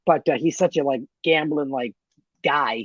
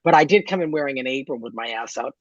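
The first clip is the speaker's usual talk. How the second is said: normal talking voice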